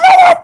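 very high sudden yelp; mock-alarmed high whoop; startled whoop. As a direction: yelp as something slips from the hand. Acts out his phone slipping away.

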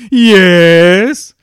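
huge drawn-out shouted yes; Frank Nelson's yes. Retold or imitated. Reviewer imitating the floorwalker's catchphrase, loud shouted yes. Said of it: "and he always went".

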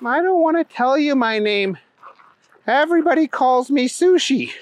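put-on high-pitched little girl voice. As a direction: talk high like a small girl.

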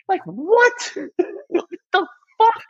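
high squeak of disbelief; can't believe what he's hearing; incredulous. Real, not acted. Host reacting to Harden's complaint, squeaky disbelief.

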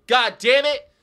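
high, loud curse; loud mock-furious curse; exasperated outburst. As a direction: snap it out, fed up. Exasperated outburst about eating shrimp.

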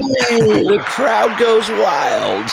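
high, loud, stretched-out playful cheer; mock crowd cheer. Host plays the crowd for Kevin's arrival, mock wild cheering.

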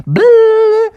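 loud, high-pitched "blah"; goofy wobbling raspberry-like cry; silly tongue noise. Acted. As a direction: blurt it with tongue stuck out.

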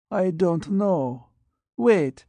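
put-on high, frail old man's voice; withered dying patient. Blind radiation patient answers the doctor, high and frail. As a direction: rasp it out like a man aged beyond his years.

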